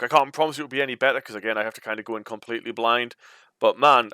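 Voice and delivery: plain reading voice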